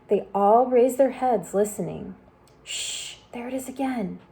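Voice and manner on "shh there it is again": unvoiced shh, then softly; whispered hush. A narrator's reading. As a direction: hush everyone to listen. Listening for distant voice.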